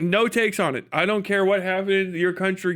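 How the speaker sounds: usual speaking voice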